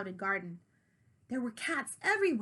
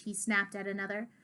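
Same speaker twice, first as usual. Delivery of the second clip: ordinary delivery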